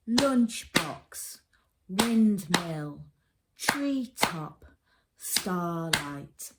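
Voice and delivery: "Lunchbox, windmill, treetop, starlight" in two distinct beats each; clapping out syllables. Shown as a demonstration. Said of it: "clap the syllables".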